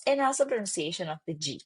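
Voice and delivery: ordinary talk, nothing marked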